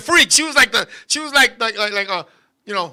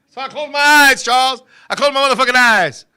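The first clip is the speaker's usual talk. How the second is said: ordinary talk, nothing marked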